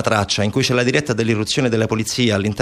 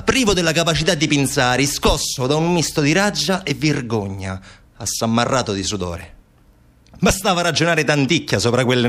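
animated, lilting literary reading; novel narration; 6 semitones higher than usual. Acted. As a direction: read the novel out with rising and falling sweep. Reads the opening of a novel.

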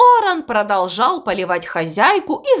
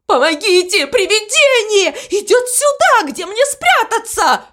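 very high, frightened shriek of a crow; panicked cry; 11 semitones higher than usual. A narrator's reading. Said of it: "закричал он". (испуганный крик высоким голосом)

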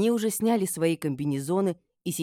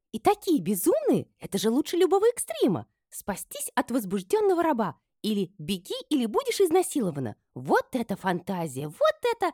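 very high, gleeful chatter; joyful chirping; 9 semitones higher, 4 dB louder than usual. A narrator's reading. Friend teases mistress about her resort, chirping joyfully. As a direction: chirp with delight. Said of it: "радостно щебетала Табита".

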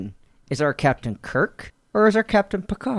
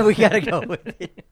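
laughing; 2 semitones higher than usual. Real, not acted. Laughing echo of the captain's line.

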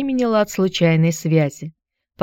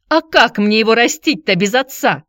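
raised, higher pleading cry; hysterical outcry; 6 semitones higher, 4 dB louder than usual. A narrator's reading. Tonya to her ex-husband, hysterical outcry. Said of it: "истерически выкрикнула Тоня".

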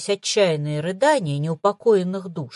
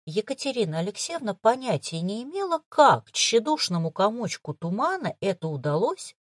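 ordinary delivery

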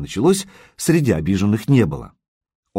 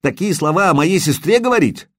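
выше и громче, гневно, быстрее; яростно защищай честь сестры; гневно кричит; 6 semitones higher than usual. A narrator's reading. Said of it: "закричал Вильд". (возмущённый крик брата за сестру)

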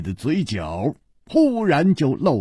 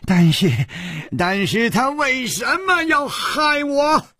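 higher, shouted desperate question; loud cry; 11 semitones higher than usual. A narrator's reading. Poisoned man crying out why he was betrayed, loud. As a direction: shout it out in disbelief. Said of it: "南宫玲大声说".

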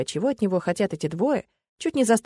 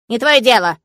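put-on louder grumbling old man; 3 semitones higher, 9 dB louder than usual. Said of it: "проворчал старик".

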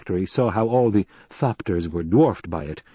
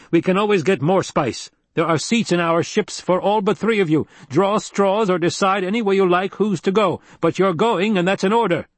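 firm, higher-pitched order; commanding Duke; 9 semitones higher than usual. Acted. Duke ordering the crew off the crawler, firm command. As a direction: lay down the law as commander.

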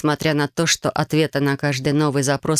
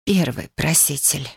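slow, weary cracked voice; exhausted and slightly cracked; slower than usual. A narrator's reading. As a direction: force it out slowly, voice worn out. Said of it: "надтреснутым голосом выговорила Юми".

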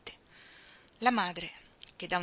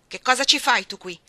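loud, higher demand; angry challenge; 4 semitones higher, 13 dB louder than usual. Acted. Frank shouts at Iggy arriving to take Ruth, loud and angry. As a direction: bark it at the intruder.

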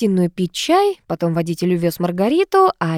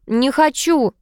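high, petulant refusal; capricious; 6 semitones higher, slower than usual. A narrator's reading. Niece refusing to smile for aunt, capricious. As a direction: refuse like a spoilt child, pitched up. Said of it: "капризно ответила племянница".

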